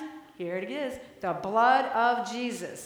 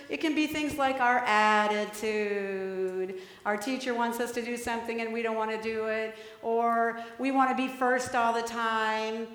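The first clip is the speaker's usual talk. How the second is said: normal talking voice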